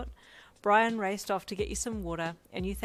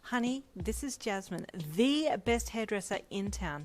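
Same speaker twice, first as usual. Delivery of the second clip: higher start, then slow stress on "the best"; emphatic; 3 semitones higher than usual. A narrator's reading. Brian introduces his favourite hairdresser, emphatic. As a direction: present with lingering emphasis on "the best". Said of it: "he said emphatically while flicking his hands".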